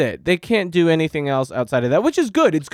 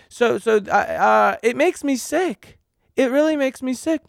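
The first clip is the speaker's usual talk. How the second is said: ordinary talk, nothing marked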